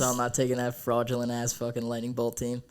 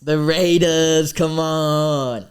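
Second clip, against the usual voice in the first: loud, slow, higher hype call; excited; 5 semitones higher, 11 dB louder, much slower than usual. Real, not acted. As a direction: drag it out like a proud fan. Fan hyping his team.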